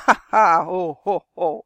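loud mocking ha-ha, ho-ho; thundering storybook giant laughter; booming giant laugh. A narrator's reading. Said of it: "donderde een rommelend gelach". (bulderende reuzenlach)

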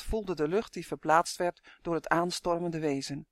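usual speaking voice

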